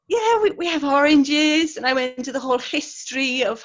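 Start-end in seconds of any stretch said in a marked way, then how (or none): 0.1-1.8 higher, louder, slow servant's reply; in-character dairymaid. Retold or imitated. Dairymaid answering doubtful visitor, in character. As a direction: assert it in role, drawn out.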